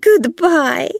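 high, loud mocking farewell; gloating Belle. A narrator's reading. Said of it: "Belle began to laugh".

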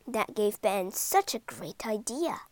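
usual speaking voice

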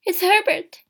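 high, loud cry of recognition; urgent, excited exclamation. A narrator's reading. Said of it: "she cried".

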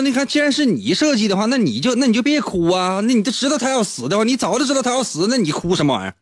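high, incredulous protest; exasperated friend. Retold or imitated. Friend scolding Flaubert for crying over his own story. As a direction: lay out the obvious logic.

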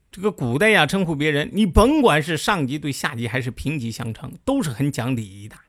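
usual speaking voice

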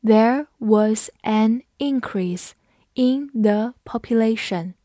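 There was an increase in the population, slow and word by word; teacher's slow, separated model reading; slow read-along. Shown as a demonstration. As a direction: read it at half speed for learners. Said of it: "我们慢读一遍".

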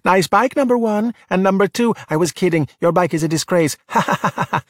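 high, jeering tease; taunt, ending in a mock laugh; sing-out sarcastic heckle. A narrator's reading. Giulia demonstrating mocking verbal assaults.

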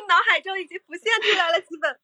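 laughing while admitting; giggling confession, a bit fast; laughing, high. Real, not acted. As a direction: talk through real laughter. Guest laughs at the item.

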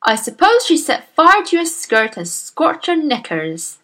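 loud, scornful sneering jeer; snorting sneer. Acted. Headmistress mocks the teacher with a loud scornful snort. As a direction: snort the words out with contempt. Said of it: "Miss Trunchbull snorted".

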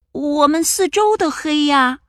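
high small cub voice; timid little bear. Acted. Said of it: "小小熊回答道".

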